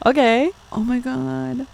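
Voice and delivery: high breathy gasp of shock. Real, not acted. Reader gasping at the romance, high. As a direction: gasp in delight.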